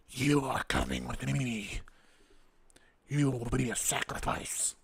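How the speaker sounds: slow, bubbly guttural fish-creature hiss; a sea monster gurgling its threat; underwater voice. Acted. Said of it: "it sounds like they're talking underwater".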